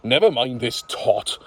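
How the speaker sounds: usual speaking voice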